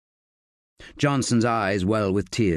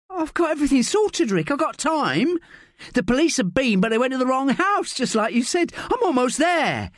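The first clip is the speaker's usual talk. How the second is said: high, breathless keen voice; eager young woman; 14 semitones higher than usual. A narrator's reading. Sally telling Rick her plan is on track, eager and high. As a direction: reassure him that all is sorted.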